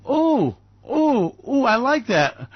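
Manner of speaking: high delighted squeal of ooh. Acted. Gloating about profits, high delighted squeal. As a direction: gush like a thrilled kid.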